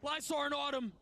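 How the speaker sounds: fast, high-pitched play-by-play hype; esports caster. Real, not acted. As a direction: call every move at speed. Live play-by-play at full hype.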